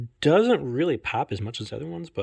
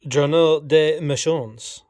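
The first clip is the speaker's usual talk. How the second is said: slow French accent, pitched up; 4 semitones higher, 7 dB louder, much slower than usual. Shown as a demonstration. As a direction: read the French title like a native. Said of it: "let me know how my French accent is".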